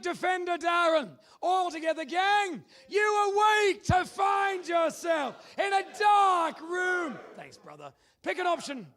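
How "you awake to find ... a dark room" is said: slow, loud, high game-show narration; dramatic announcer. Acted. Host opens the dungeon round, slow and booming.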